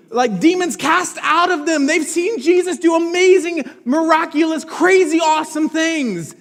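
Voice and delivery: loud, high, fired-up preaching; preaching at full force. Real, not acted. Pastor to the church, rising preaching fervour. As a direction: build the list of miracles with fire.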